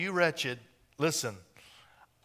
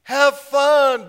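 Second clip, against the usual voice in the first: higher and louder encouragement; God cheers the couple on; cheering God; 9 semitones higher, 8 dB louder, much slower than usual. Retold or imitated. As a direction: urge them on warmly. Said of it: "God was saying".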